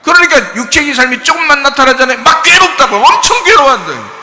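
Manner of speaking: loud, high, driving sermon voice; full-force preaching. Acted. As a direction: preach it hard and loud to the congregation.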